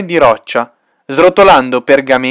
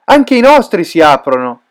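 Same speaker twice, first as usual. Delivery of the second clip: ordinary delivery, nothing marked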